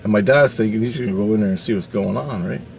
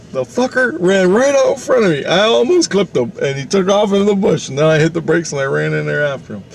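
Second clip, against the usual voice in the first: high, worked-up retelling easing down; excited uncle; 8 semitones higher than usual. Retold or imitated. Uncle recounting his near miss to family, excited. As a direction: re-enact the near miss, starting way up in pitch.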